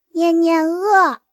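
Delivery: put-on high, drawn-out little-child voice; slow childish plea for food; toddler whining. Three-year-old telling mother of hunger, drawn-out childish whine.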